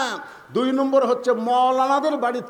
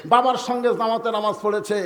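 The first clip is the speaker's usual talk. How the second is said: raised, loud sermon declaration; full-force preaching; 3 semitones higher, 7 dB louder than usual. Acted. Preacher declares father and son heaven-bound, full-force preaching. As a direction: proclaim it and rouse the crowd.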